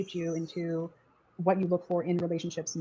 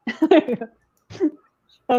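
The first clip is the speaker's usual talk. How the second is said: laughing; 8 semitones higher, 11 dB louder than usual. Real, not acted. Laughing at the cluck.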